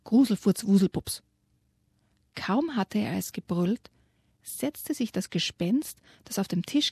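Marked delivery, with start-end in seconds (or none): 0.0-1.2 mild angry yell. Acted. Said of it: "noch zweimal".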